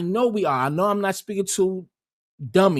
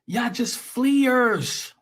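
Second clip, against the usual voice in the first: drawn out very slowly, "fleers" hit hard; scornful stress; 5 semitones higher, much slower than usual. Acted. Ranting at African viewers, slow scornful stress. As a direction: stretch "Y'all just fleers" slowly with contempt. Mocking emigrants in a rant.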